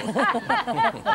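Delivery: high burst of laughter; high, giggly laugh; amused laugh. Real, not acted. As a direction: crack up at your own joke. Laughs at own pun.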